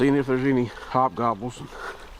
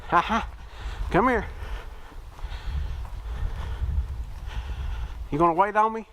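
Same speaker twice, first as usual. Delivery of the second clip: calling the dog; 9 semitones higher than usual. Real, not acted. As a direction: call her over fondly, pitched up. Calling his dog Sadie.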